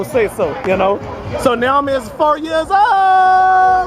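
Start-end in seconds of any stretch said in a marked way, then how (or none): 1.4-3.9 excited announcement. Real, not acted. Announcing the birthday girl.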